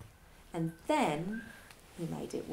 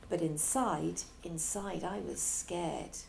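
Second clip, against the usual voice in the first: ordinary delivery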